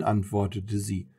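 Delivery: plain reading voice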